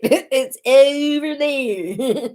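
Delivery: giggling, drawn out; laughing. Real, not acted. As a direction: laugh through the words. Laughing, slow.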